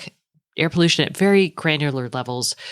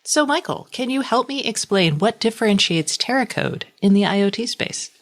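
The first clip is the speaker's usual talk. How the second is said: upbeat, higher-pitched podcast ad read; sponsor read; 5 semitones higher than usual. Real, not acted. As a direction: introduce the sponsor like a polished host. Reads a sponsor message.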